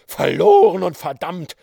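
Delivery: loud, defiant battle cry; rally your men with fury; pirate chief roaring his men to him; hoarse rallying yell. A narrator's reading. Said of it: "schrie er mit heiserer Stimme".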